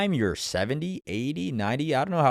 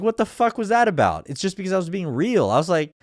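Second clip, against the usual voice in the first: ordinary talk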